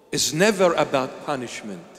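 ordinary talk

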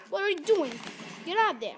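high, loud frustrated yell. Real, not acted. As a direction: bark orders at the horse. Yelling at the horse in game.

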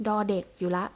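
normal talking voice

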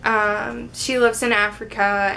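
ordinary delivery

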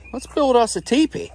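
usual speaking voice

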